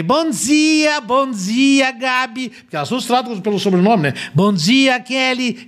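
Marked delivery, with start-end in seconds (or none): 0.0-2.5 high, sing-song cheery morning greeting; imitating Kelly Matos. Radio colleague mocking a morning host's over-happy greeting, high and sing-song. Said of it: "a Kelly Matos de manhã ali". 4.3-5.7 high, sing-song cheery morning greeting; imitating Kelly Matos. Radio colleague mocking a morning host's over-happy greeting, high and sing-song. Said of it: "a Kelly Matos de manhã ali".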